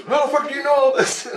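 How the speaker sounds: very high astonished voice with a laugh; amazed laughing shout. Retold or imitated. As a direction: blurt it out, laughing in disbelief. Guide stunned, laughing.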